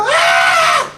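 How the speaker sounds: high, loud "Ah!" of an eager crowd; hammed-up crowd gasp; mock excited gasp. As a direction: squeal like a thrilled voter. People excited over politicians.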